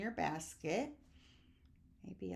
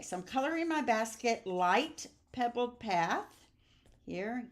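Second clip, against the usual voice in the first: usual speaking voice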